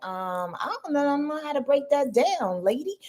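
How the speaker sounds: confused newcomer. Retold or imitated. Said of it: "you're like".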